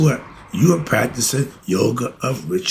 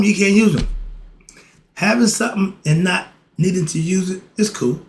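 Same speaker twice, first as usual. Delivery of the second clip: usual speaking voice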